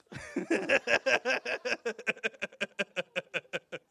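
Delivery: high, loud steady ha-ha laughter. Shown as a demonstration. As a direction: keep the laugh going. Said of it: "Have some more".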